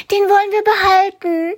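put-on very high, pleading child's voice; a child wanting to keep the rabbit; eager child. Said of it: "hört Karl-Heinz ein Kind sagen". (hohe, eifrige Kinderstimme)

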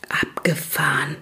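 breathy awed whisper to a classmate. A narrator's reading. Benny whispers to Ida in class, impressed whisper. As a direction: lean over and whisper it, impressed. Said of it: "flüsterte Benny neben Ida".

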